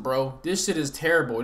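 usual speaking voice

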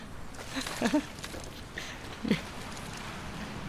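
breathy laugh. Real, not acted. Laughs at own question.

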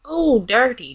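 ordinary talk, nothing marked

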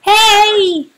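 very loud, high "hey"; big high-pitched holler of greeting; excited greeting. Real, not acted. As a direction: shout a delighted hello down the line. Greeting caller who finally connects.

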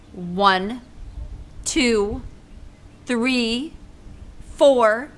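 loud, rising stern count; warning count. Acted. Teacher counting at Junie B. to make her go, stern. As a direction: count it out as a threat.

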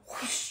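long, breath-blown whoosh; whooshing noise. A narrator's reading. Storyteller voicing Tortoise taking off, whooshing. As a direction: stretch it out like rushing wind.

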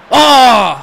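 loud startled oh; surprised shout. Real, not acted. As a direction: blurt it out, startled. Reaction to a play.

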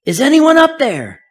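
high desperate yell upward; yell to heaven. Acted. Skier dangling from cliff shouts to heaven, desperate high yell. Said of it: "he yells to heaven".

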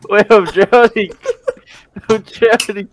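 slow, loud, laughing cry of drowning; mock panic through laughter. Real, not acted. Player's character runs out of air, laughing panic.

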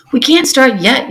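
yelled. A narrator's reading. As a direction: call out over the noisy crowd. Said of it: "yelled one of the voice".

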